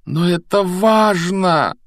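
high, drawn-out plea; insistent. A narrator's reading. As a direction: insist with every word stretched. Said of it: "настойчиво произнёс Гарри".

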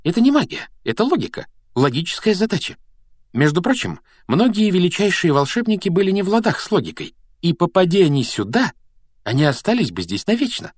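put-on high-pitched, excited girl's explanation; eager clever Hermione. A narrator's reading. Hermione tells Harry it is logic, excited. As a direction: voice a keen know-it-all, pitched well up.

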